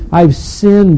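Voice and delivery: loud, high confession; remorseful outburst. Retold or imitated. Judas confessing to the chief priests, loud remorseful outburst. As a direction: cry it out as a confession. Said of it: "he was seized with remorse".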